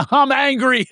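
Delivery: high-pitched, laughing mock anger; gleeful fake-angry exclamation; angry words laughed. Shown as a demonstration. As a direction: announce anger while bursting into giggles. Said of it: "you say it with me".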